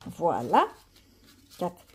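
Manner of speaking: ordinary talk, nothing marked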